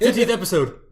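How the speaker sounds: excited. Real, not acted. First call of milestone.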